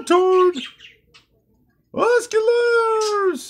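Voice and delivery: high triumphant yell; excited shout. Acted. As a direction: shout it out in glee. Patrick's turn, wins escalators.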